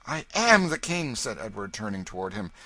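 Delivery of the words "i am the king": loud, high royal retort; defiant insistence. A narrator's reading. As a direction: insist on it, voice raised. King angrily contradicts his accuser.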